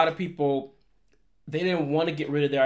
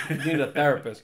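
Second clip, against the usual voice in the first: laughing. Real, not acted. Joking about chitlins.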